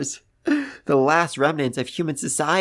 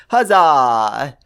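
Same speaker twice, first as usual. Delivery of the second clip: loud, drawn-out huzzah; big celebratory hurrah; triumphant cheer; 6 dB louder than usual. Real, not acted. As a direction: cheer it out loudly like a victory cry. Cheering the scientists back together.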